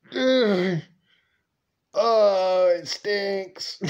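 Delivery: retold gagging disgust; grossed-out groan at the stink; a kid going ugh at a smell. Disgust at squirrel guts.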